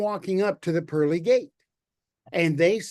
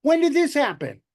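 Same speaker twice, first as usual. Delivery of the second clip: high, loud disbelieving question; surprised Peter; 9 semitones higher, 4 dB louder than usual. Acted. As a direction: Peter caught off guard, voice jumping up. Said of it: "And Peter's like".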